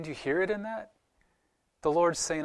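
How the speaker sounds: normal talking voice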